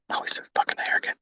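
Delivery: fast whispered jab at himself; mock critic whispering; whispered aside. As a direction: whisper it like a heckler in his head.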